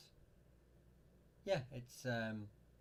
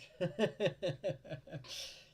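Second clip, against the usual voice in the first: short laugh; 7 semitones higher, 4 dB louder than usual. Real, not acted. Laughs after reading a comment.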